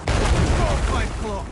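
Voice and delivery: loud urgent call; shouting. Acted. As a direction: call out the name to send him off. Ordering companion to run.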